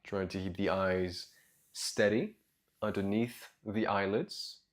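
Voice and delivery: soft, low meditation voice with long pauses; guided meditation. Real, not acted. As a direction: guide them gently into their breath, quietly and low.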